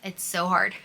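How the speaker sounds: usual speaking voice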